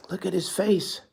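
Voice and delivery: ordinary delivery, nothing marked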